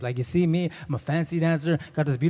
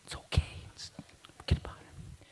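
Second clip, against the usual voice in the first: soft urgent whisper; whispering shush; whispered hushing; 3 semitones higher, 7 dB quieter than usual. Retold or imitated. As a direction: shush him so the church won't hear. Uncle hushing nephew in church.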